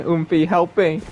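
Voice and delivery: ordinary talk, nothing marked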